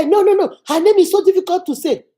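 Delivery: high-pitched mock protest; flustered cook imitated. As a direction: imitate her making excuses to the boss. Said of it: "She told the guy".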